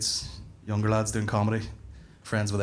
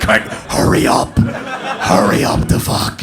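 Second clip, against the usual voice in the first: slow, loud, strained rasp; desperate urging; 2 semitones lower, 8 dB louder, much slower than usual. Retold or imitated. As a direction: force it out loud through a voiceless rasp. Sweating, desperate for his turn.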